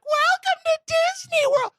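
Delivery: a terrified cast member forcing cheer; manic greeter. Retold or imitated. Disney World staff greeting guests in a manic sing-song. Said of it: "They're like".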